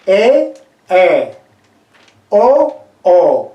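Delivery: E, E, O, O said loud as open vowels; open vowel demo. Shown as a demonstration. Teacher models the open vowels for the class. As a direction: call out E, E, O, O with wide vowels. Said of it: "más abierta".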